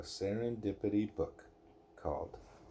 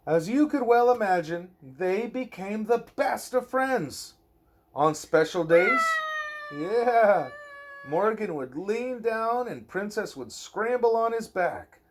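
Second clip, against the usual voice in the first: lilting bedtime-story read-aloud; reading to a child; 9 semitones higher, 8 dB louder than usual. Acted. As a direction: read it gently to a little one at bedtime. Reading a picture book aloud.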